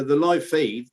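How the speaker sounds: usual speaking voice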